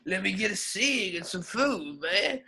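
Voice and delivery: slow and soft asking for a cig; a hint of a weary panhandler; lightly voiced homeless man. Homeless man begging a passerby, slow soft plea.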